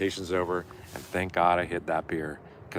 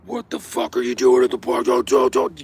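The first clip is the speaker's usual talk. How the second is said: loud mock yell from a riled-up cop; imitating cop yelling; 3 semitones higher, 8 dB louder than usual. Cop yelling at skateboarders in the park, mock shout. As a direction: bark it like an angry cop at kids. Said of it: "yelling all this shit".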